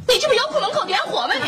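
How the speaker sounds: high, fast rebuke; exasperated scolding. Acted. Woman scolding a tactless flower courier, exasperated. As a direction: rattle it off, fed up.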